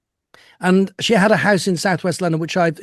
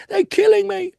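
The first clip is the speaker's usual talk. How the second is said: imitated scream; 12 semitones higher than usual. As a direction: shriek it like his mother screaming at carers. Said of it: "She'd scream at them".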